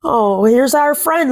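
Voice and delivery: plain reading voice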